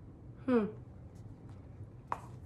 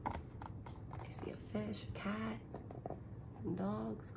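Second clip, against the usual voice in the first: hushed unvoiced whisper; 18 dB quieter than usual. Real, not acted. Whispering the pets on the back cover to the children. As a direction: breathe the words to the class. Teacher whispers while showing pictures.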